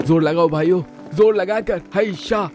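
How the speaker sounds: straining push, rising high; heave-ho effort cry. Acted. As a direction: call out like hauling heavy weight. Pushing house, heave-ho cheer.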